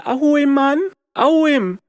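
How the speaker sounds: high mock voice of the guilty; high, scoffing imitation of critics; mocking quote. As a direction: squeal it like an offended person. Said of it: "people are going to say".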